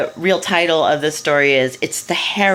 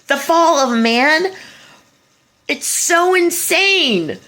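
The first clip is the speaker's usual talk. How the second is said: much higher, slow disbelief; incredulous; 11 semitones higher, slower than usual. Real, not acted. The speaker marvels at the story's title, slow and incredulous. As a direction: stretch it out, amazed at the absurdity.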